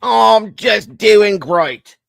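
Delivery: very slow, high, loud Cockney; hammed-up Cockney with stretched vowels; mock Cockney accent. Answering the co-host in goofy mock Cockney. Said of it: "Is that a Cockney accent?".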